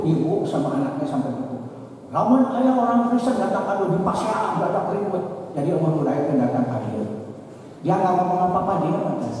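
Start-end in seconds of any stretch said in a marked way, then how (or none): 2.1-5.2 high-pitched Sundanese mother's complaint; complaining mother. Retold or imitated. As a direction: act out a mother grumbling, pitched well up.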